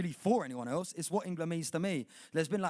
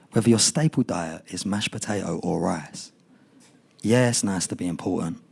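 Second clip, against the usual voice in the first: poem performance; 13 dB louder than usual. Acted. Poet performing to the crowd at a poetry night, loud and measured.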